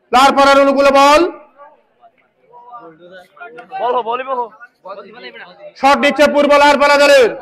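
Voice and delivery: fast excited match commentary; frantic football commentary. Real, not acted. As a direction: call the action live, urgently. Live play-by-play commentary.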